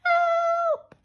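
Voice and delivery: very high, loud shriek of help; mock scared cry. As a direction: squeal as if terrified. Frightened cry.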